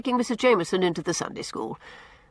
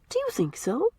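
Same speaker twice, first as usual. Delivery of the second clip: a sudden, higher question, softer; 10 semitones higher, 5 dB quieter than usual. A narrator's reading. Anne coming back from dreamland, asking suddenly. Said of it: "asked Anne suddenly".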